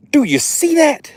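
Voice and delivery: high, thrilled question; excited high. Real, not acted. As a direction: show it off. Showing the fish.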